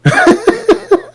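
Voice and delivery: a sudden high burst of laughter; loud laugh. Real, not acted. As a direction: crack up loudly. Outburst at partner still talking.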